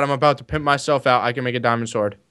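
ordinary delivery

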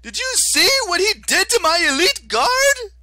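whiny high-pitched fury, dragged out; Octavian, shrill and outraged. Acted. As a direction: screech like a wounded petty tyrant. Octavian character voice.

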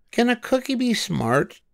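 high, cheerful storybook voice for Amelia; Amelia Bedelia voice. A narrator's reading. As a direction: lift into a bright girlish character voice. Amelia asks cousin.

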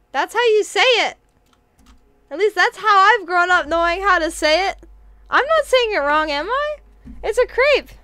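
loud, high-pitched insistence; defensive protest. Real, not acted. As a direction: protest it loudly, sure you are right. Defending her pronunciation to chat.

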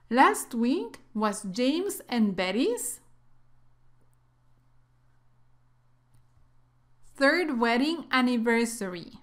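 slow, clear model reading; careful teacher model sentence; slow read-aloud. Shown as a demonstration. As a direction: read it slowly for students to repeat. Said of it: "en voz alta".

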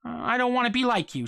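ordinary delivery, nothing marked

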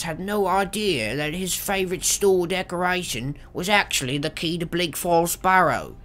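high strained voice for Arvel; Arvel journal voice. Pretend. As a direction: read the journal as a nervous thief. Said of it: "what do they sound like?".

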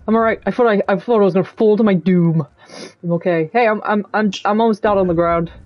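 ordinary talk, nothing marked

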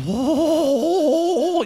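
high, slow, drawn-out mock chuckle; nervous parent laugh. Comedian imitating the nervous parents beside him with a slow high hoho. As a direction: ham up the anxious parents' hoho. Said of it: "machen die ganze Zeit".

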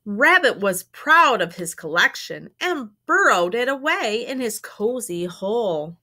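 animated, emphatic storytime narration; story read to children. A narrator's reading. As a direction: read it to young children, punching key words. Reads a picture book aloud.

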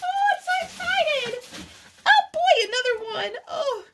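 thrilled squeal. Real, not acted. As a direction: bubble over with delight at the gift. Unwrapping a second gift book.

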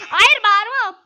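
high, loud outburst; real gamer shout. Real, not acted. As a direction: yell it out full force. Shouting at himself.

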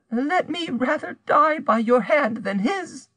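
very high, slow, imploring voice; desperate plea. A narrator's reading. Rose begs to die by madame's hand, desperate. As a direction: draw out the plea in high despair.

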